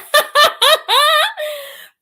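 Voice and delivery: loud, high burst of laughter; delighted high cackle; big laugh. Real, not acted. Laughing at her own accent.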